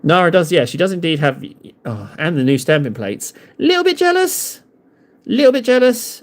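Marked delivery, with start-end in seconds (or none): 3.6-4.6 very high, sustained little voice; tiny falsetto confession; mock-jealous squeak. Mock confession of envy, sung high. 5.2-6.2 high, playful little voice; mock-jealous squeak. Repeats mock confession of envy.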